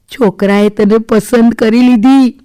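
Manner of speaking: slow, loud, delighted announcement. A narrator's reading. Mother tells daughter the boy has chosen her, delighted. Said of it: "મમ્મીએ હરખાતા કીધું".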